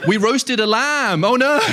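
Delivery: high, slow, sing-song fake surprise; playing innocent while visiting; fake innocent neighbor. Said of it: "You know that they're like".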